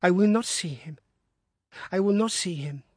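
sick wife refusing to see her husband; feverish refusal. Acted.

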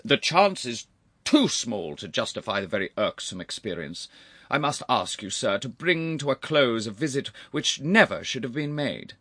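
touchy uncle. Acted.

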